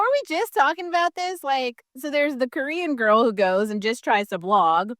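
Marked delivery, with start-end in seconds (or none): none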